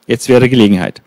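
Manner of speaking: usual speaking voice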